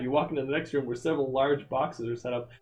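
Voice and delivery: usual speaking voice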